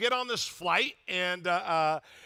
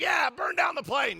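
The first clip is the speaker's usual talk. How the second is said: mock yell; 6 semitones higher than usual. Grumpy passenger yelling down the plane, mock shout. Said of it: "I'm like".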